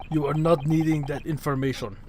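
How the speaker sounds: put-on Russian accent. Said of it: "just do Stroganoff voice".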